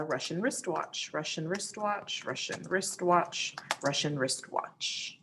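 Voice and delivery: slow, soft repeated articulation drill; careful tongue twister. Shown as a demonstration. Teacher demonstrating vocal warm-up for students, slow careful tongue twister. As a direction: take the tongue twister slowly and quietly.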